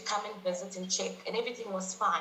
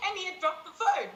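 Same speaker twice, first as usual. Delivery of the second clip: high breathy laugh spilling into words; laughing while telling; 9 semitones higher than usual. Real, not acted. Guest laughs recalling dad dropping the phone, giggling. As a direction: giggle through the punchline.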